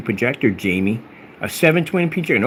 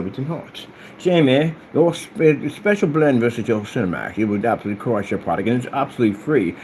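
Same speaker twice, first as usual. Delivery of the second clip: normal talking voice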